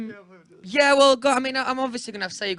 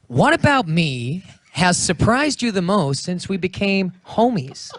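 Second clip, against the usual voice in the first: slow, drawn-out American accent; slower than usual. Shown as a demonstration. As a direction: put on American, stretching the words. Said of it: "in an American accent".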